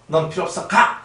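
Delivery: 높고 크게 가를 외쳐; 냉정하게 쫓아내며 외쳐; 내쫓는 호통. Acted. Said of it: "하면서". (높은 목소리로 쫓아내는 호통)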